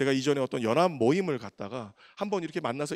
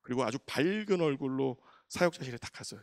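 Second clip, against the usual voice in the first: usual speaking voice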